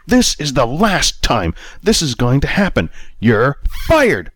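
slow, high, hammered-out fury; enraged boss dragging out the sentence; firing in a rage. A narrator's reading. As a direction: spit each word as you fire him. Manager firing Anthony.

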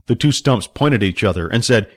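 ordinary delivery

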